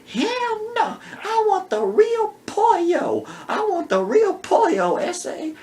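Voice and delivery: angry high woman. Retold or imitated. Outraged wife refuses the blanket, high sassy voice.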